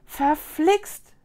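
startled little outburst; exclaimed curse. A narrator's reading. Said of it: "rief Mila".

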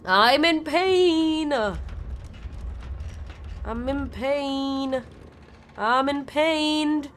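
slow, drawn-out mock whimper; slow mock moan of pain while playing. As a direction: drag it out like a hammed-up groan. Mock moaning in game.

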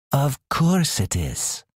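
drawn-out, haughty assurance; disdainful. A narrator's reading. Cat answers Alex doubting the way, disdainfully. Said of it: "Shieri said disdainfully".

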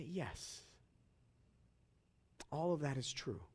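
very quiet, low and unhurried; soft voice. Acted. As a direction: confide it softly, leaving space. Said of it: "lean forward in a soft voice".

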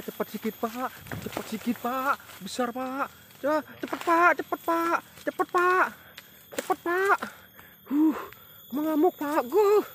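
rising from high calls to very high, loud yells; urgency builds until he's shouting; building excited shouts. Real, not acted. Urging the helper while the fish thrashes, building to high shouts.

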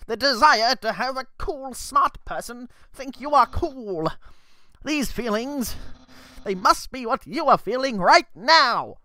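boastful skeleton, lightly voiced and grand; Papyrus voice. Skeleton guard boasts to the human, lightly voiced. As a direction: puff up like a vain, eager skeleton. Reads Papyrus dialogue aloud.

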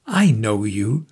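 low, slow, gentle firmness; kind severity. A narrator's reading. Visitor answering Markheim, kind severity. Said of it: "a sort of kind severity, or rather firmness".

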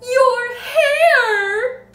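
very high, loud horrified shriek. A narrator's reading. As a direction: shriek it at your husband, stretched out. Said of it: "the mother was shrieking".

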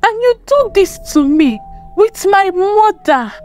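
high-pitched, wounded indignation; blazing anger. A narrator's reading. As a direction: angrily confront a husband over betrayal. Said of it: "her eyes blazing with anger".

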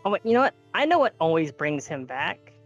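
ordinary talk, nothing marked

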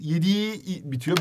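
ordinary delivery

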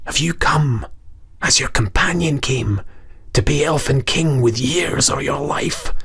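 soft, breathy warning from a small creature, sinking to a whisper; goblin's hushed plea. Acted. Goblin warning the Earl to turn back, in a hushed whisper. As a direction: plead under your breath for him to turn back. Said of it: "and whispered to him also".

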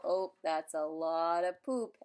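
ordinary delivery

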